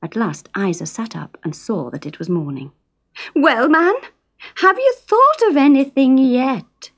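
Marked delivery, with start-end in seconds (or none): 3.4-6.9 high insistent Geordie wife; nagging wife. A narrator's reading. Isa pressing Fred again at dawn, high and loud. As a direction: push him for an answer.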